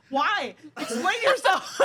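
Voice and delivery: slow high laughing order; laughing demand. Real, not acted.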